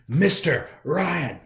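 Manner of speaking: slow, loud final name; loudest take. Shown as a demonstration. Reader finishing his theme song at full volume. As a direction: belt the name out big. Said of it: "getting louder each time".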